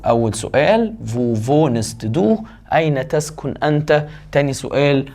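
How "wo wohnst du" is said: slow dictation. Shown as a demonstration. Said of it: "slow model of the question".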